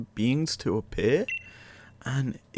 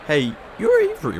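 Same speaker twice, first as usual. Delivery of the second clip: much higher, bright surprised hello; surprised greeting; 4 dB louder than usual. Acted. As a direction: spot a familiar face and perk up. Greets a newcomer in cutscene.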